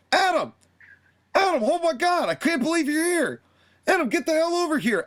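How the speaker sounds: high excited call across the room. Retold or imitated. Dustin spotting Adam Page across the way, calling him over.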